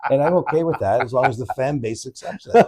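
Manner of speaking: amused co-host laughing as he answers the teasing; laughing through words. Real, not acted. Co-host laughing while teasing back.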